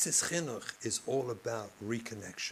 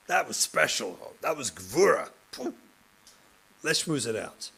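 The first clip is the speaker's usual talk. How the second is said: enthusiastic praise; 2 semitones higher, 4 dB louder than usual. Retold or imitated. Teacher praising student enthusiastically.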